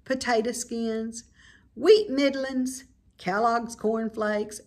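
ordinary delivery

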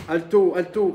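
sentry nervously repeating his challenge, loud; nervous repeated shout. Acted. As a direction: call out twice, jittery and urgent. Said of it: "répétait la voix nerveusement".

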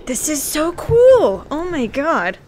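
loud, high and drawn out with delight; slow, squealing real delight; excited shout. Real, not acted. Thrilled by game effect.

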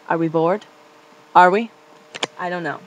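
ordinary talk

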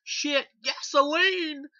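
high, loud, drawn-out hammy cry; mocking bad acting. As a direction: ham it up like a terrible actor. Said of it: "a really horrible acting job".

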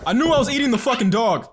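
loud, fast exasperated blurt; frustrated outburst. Real, not acted. Gamer realizing he ate the dog, loud fast outburst. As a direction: spit it out, fed up.

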